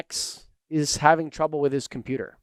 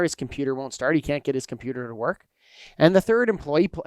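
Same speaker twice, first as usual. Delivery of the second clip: ordinary delivery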